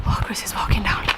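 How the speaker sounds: whispered aside as someone approaches. Real, not acted. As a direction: drop to a whisper so he won't hear. Aside while Chris approaches.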